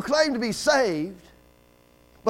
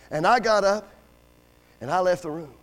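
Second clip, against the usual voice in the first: usual speaking voice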